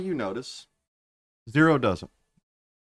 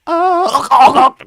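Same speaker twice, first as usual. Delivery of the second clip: high, loud yelp from a throat punch; choked yelp; 13 semitones higher, 12 dB louder than usual. Acted. Specs yelps when punched in the throat. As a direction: squeal as the fist hits.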